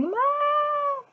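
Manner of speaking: high mock scream of mom; imitated daughter screaming. Daughter screaming for mom over a spider, high call. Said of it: "When she's screaming".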